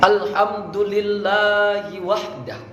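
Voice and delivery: measured Arabic opening. Shown as a demonstration. Coach models the praise for students, slow and measured. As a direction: recite it slowly, syllables held.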